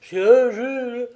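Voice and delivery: high, loud echo of alarm. A narrator's reading. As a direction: repeat it in greater fright, as the wife. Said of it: "encore plus épouvantée que lui".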